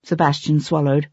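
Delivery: ordinary delivery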